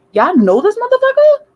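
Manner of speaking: screaming at the cops in outrage; outraged yell. Retold or imitated. Yelling at police, emotional retelling.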